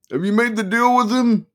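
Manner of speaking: high, loud manic lawyer; excited manic attorney. Acted. Attorney voiced.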